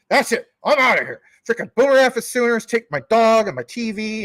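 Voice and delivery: high, loud huffy outburst; mock outrage. Viewer storming out over being called a hypocrite, mock outrage. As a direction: blurt it high and loud, playing the offended viewer.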